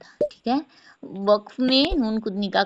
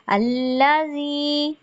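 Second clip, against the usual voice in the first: very slow, higher tilawat; Quranic recitation; 5 semitones higher, much slower than usual. Shown as a demonstration. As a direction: stretch अल्लाजी out. Recitation demo. (धीमी तिलावत)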